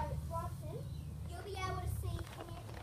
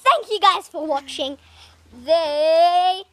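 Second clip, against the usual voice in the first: loud, drawn-out outro; loud slow sign-off; 12 dB louder, slower than usual. Real, not acted. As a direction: announce the outro slowly and loud.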